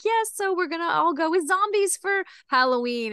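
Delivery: brightly fake-casual wife; breezy Dot. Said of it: "But she's like".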